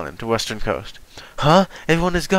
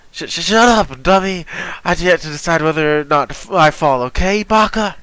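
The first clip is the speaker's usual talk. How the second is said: put-on high, loud flustered girl; flustered tsundere; 6 semitones higher, 5 dB louder than usual. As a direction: stammer it out, embarrassed. Flustered tsundere Pudding retort.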